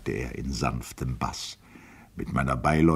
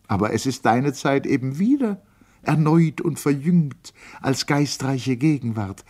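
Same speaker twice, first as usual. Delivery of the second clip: put-on raised, lively older lady; Lotte's voice; 8 semitones higher, 5 dB louder than usual. A narrator's reading. Lotte teasing Goethe about Du and Sie, in a raised lively voice. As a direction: speak up brightly as an old lady teasing him.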